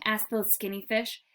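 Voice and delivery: plain reading voice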